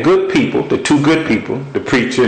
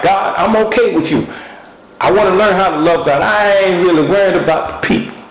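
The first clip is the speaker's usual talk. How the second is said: upset plea; 7 semitones higher than usual. Retold or imitated. Preacher retelling his upset prayer to God, worked up and higher. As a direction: pour out a frustrated complaint to God. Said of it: "And I'm all upset".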